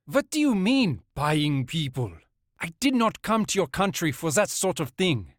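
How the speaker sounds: put-on German accent, confused and defensive. A narrator's reading. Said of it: "He had a German accent".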